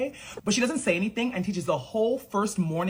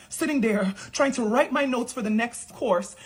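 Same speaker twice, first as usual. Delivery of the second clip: usual speaking voice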